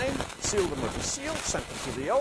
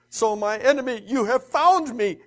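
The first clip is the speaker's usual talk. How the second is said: exclaimed; 7 semitones higher, 11 dB louder than usual. Acted. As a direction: cry out at your enemy. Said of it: "Ahab exclaimed to Elijah".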